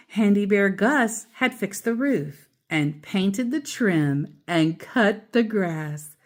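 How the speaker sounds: ordinary delivery, nothing marked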